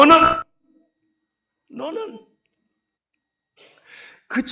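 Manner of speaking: commanding shout of reproach; stern divine rebuke. Pretend. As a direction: thunder it as God calling him out. God's voice rebuking.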